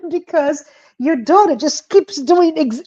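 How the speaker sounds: giggling while explaining; laughing. Real, not acted. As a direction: laugh through the words.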